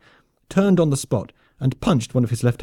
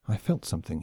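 inner thought; 4 semitones lower, 7 dB quieter than usual. Acted. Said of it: "he thought".

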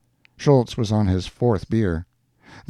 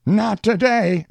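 high, slow singsong brush-off; joking postman; 14 semitones higher, 4 dB louder, slower than usual. A narrator's reading. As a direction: voice a postman calling back, pitched well up. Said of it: "laughing as he stuffed the other five mailboxes".

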